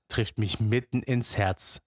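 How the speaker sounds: usual speaking voice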